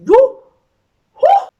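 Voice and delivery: loud high whoop. Real, not acted. Reliving the beating for viewers, with a loud whoop. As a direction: let out a big whoop.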